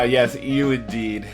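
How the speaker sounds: normal talking voice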